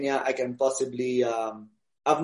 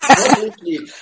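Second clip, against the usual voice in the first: laughing it out, high and bright; a tired new dad's real laugh; 7 semitones higher, 7 dB louder, slower than usual. Real, not acted. New dad laughing as he answers the host about losing sleep.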